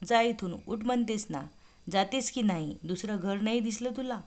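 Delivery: plain reading voice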